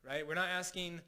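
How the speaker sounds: normal talking voice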